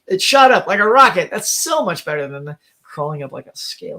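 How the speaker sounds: usual speaking voice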